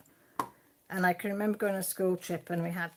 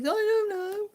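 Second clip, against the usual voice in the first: put-on high, loud childish whine; daughter's whiny reply; 13 semitones higher, 7 dB louder, slower than usual. Mother imitating her daughter's whiny answer. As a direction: mimic a sulky child.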